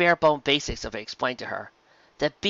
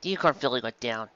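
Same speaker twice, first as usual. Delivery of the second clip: ordinary delivery, nothing marked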